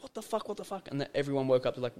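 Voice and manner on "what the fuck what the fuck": high, hushed, panicky repetition; retold panic. Recounting running out to brothers and mum, panicked. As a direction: act out freaked-out disbelief in a high, soft voice.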